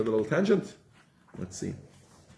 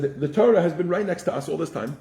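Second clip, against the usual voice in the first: higher, laughing angels pressing their claim; angels claiming rights; 7 semitones higher than usual. Retold or imitated. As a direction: argue like eager neighbors ready to pay anything. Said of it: "They said".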